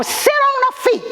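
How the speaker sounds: high, sharp barked order; a retold order, pitched high and hard; sheriff's harsh command. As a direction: snap it like the cruel sheriff.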